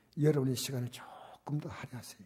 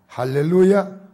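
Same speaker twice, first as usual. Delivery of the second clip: loud, higher ringing hallelujah; exclaimed hallelujah; 4 semitones higher, 14 dB louder, slower than usual. Real, not acted. Pastor rallies congregation after the verse, exclaimed hallelujah. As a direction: call it out loudly to the congregation.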